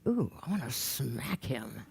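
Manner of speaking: low, quiet, slow seething; acted pent-up irritation; suppressed anger. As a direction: hold the anger in, drawn out and hushed. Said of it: "And she would go".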